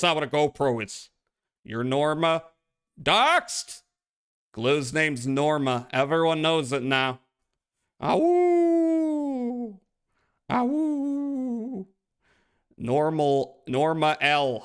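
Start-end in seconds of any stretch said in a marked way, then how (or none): 3.0-3.8 gleeful. Pretend. Announcing chatter's name. 8.0-11.9 high yippy wolf howl; howling like a wolf pup. Pretend. Streamer howls at chat.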